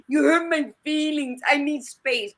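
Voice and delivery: mock wounded protest; mocking hurt feelings. Mocks someone playing the victim.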